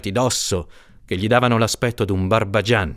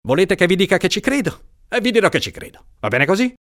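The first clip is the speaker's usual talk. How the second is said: higher, sarcastic rising challenge; exasperated; 10 semitones higher, faster than usual. Acted. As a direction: toss it back with irritated irony. Judge retorts in exasperation.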